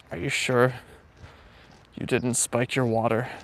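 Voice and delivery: ordinary talk, nothing marked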